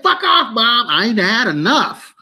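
high, defiant brat voice; mock child cussing at a parent; bratty kid. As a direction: talk back like a cheeky kid. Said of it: "and he said".

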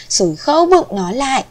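ordinary delivery, nothing marked

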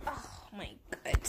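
whispered, slow oh my God; slow, whispered disbelief; stunned whisper. Real, not acted. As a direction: breathe it out in shock. Stunned after losing round.

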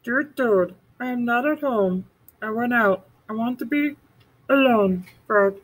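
high storybook voice rereading the note. Acted. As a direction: read the note again in a high voice.

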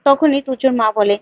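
ordinary delivery

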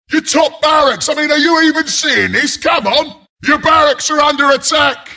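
high, exasperated warning calls; urgent alarm. Acted. Announcer to player ignoring barracks attack, urgent alarm. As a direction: badger the player urgently to defend.

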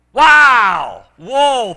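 loud, high whoop of delight; excited exclamation. Retold or imitated. Player handed a big bag of coins, excited exclamation. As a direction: reenact being thrilled by a windfall.

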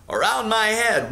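ordinary delivery

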